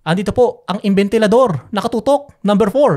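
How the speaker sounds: on-air parody of a news anchor, loud and punchy; Mike Enriquez impression. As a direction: ham up a newsman's dramatic report. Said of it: "impression, di ba".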